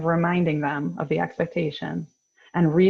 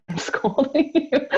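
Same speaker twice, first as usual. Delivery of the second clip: laughing; 7 semitones higher, slower than usual. Real, not acted.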